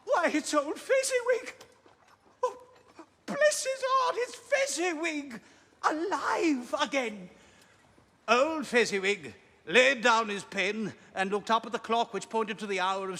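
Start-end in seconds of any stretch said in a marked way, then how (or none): 0.1-7.3 much higher, thrilled cry of recognition; overjoyed, then slowing in wonder; great excitement. Acted. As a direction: burst out like seeing an old friend alive. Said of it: "Scrooge cried in great excitement".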